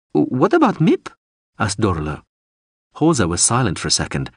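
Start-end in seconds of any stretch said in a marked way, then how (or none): 0.1-1.1 high, bright-pitched small woman; high woman's voice. A narrator's reading. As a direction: ask it in her high voice. Dorolo voiced high, worried.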